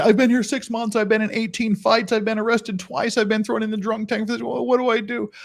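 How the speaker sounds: a hint of a hapless expat pleading; clueless newcomer. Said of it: "they say".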